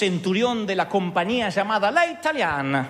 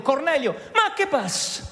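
mock Italian reply; 7 semitones higher than usual. Angel answered in Italian manner.

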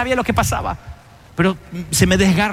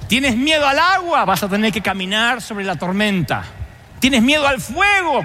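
predicación; 4 semitones higher than usual. Real, not acted. Sermón en crescendo.

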